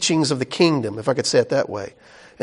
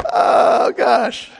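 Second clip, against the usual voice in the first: higher, amused "oh gosh" through laughter; laughing; 5 semitones higher than usual. Real, not acted. Preacher reacting to his own anecdote, laughing. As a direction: sigh it out after a good laugh.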